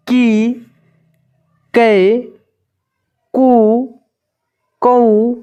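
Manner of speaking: each syllable called out alone with long pauses, teacher's drill; slow drilled syllable recitation. Shown as a demonstration. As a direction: recite the barakhadi one syllable at a time, clearly. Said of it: "यहाँ पे देखिए". (शिक्षक की तरह एक-एक अक्षर धीरे-धीरे बोलना)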